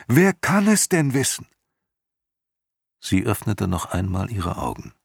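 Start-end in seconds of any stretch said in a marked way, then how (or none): 0.1-1.5 very high, loud demand; Atreju shouting louder. A narrator's reading. Boy demanding who else knows, desperate high shout. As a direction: yell it at the peak of desperation. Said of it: "schrie Atreo immer lauter".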